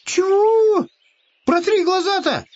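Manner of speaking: very high, loud outraged squeal; indignant hare. Acted. Hare snubbed by the bear, high and indignant.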